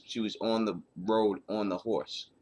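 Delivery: usual speaking voice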